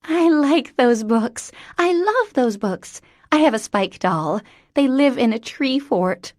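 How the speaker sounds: put-on high, bright-eyed childlike voice; an eager little girl in a grown woman; childlike Mary Elizabeth.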